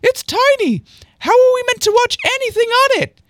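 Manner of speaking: very high, loud squeaky protest; C C's voice. Acted. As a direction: protest in an indignant falsetto. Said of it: "I forget what C C's voice is".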